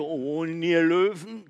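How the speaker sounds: distant echoing repeat. Acted. The cave echoing the goat's call, distant. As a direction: sound like the cave calling back. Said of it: "Und das Echo".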